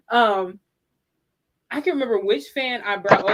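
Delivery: ordinary talk, nothing marked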